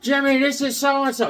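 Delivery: high, loud shop owner calling out; Harvey impression. Harvey welcoming Jimmy into the back room, high and loud. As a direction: call them in like a jolly shop owner. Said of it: "This is my impression of Harvey".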